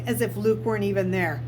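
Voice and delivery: ordinary delivery, nothing marked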